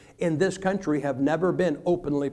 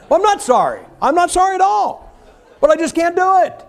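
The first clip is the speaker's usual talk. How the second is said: much higher and louder, laughing; gleeful laughing aside; 13 semitones higher, 9 dB louder than usual. Real, not acted. Preacher tells the church he isn't sorry, laughing. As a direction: revel in it, laughing.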